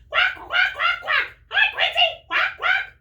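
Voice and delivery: very high, very loud quacking duck; squawking cartoon duck, high and loud; loud duck shout. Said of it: "shouts Dottie".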